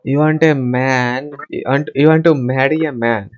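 normal talking voice

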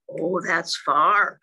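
gentle big hare settling the little one; slow tender father. Acted. Father hare soothing his sleepy son, slow and tender.

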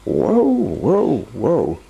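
high startled whoas; exclaiming. Acted. Wizard seeing money roll everywhere, high amazed exclaim. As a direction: gasp out whoa at the spilled coins. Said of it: "exclaimed the wizard".

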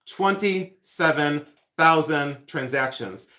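"Twenty-seven thousand transactions" drawn out, word by word; slow emphatic repeat. Real, not acted. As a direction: spell out the weight of each word, slowly.

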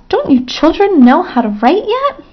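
mock despair at the class; disgusted. A narrator's reading. Stuart scolding the children about writing, mildly disgusted. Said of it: "said Stuart in disgust".

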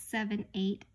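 ordinary delivery, nothing marked

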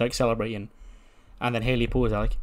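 ordinary talk, nothing marked

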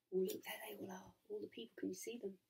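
very soft, quick picture commentary; hushed aside. Real, not acted. Aside to child about picture.

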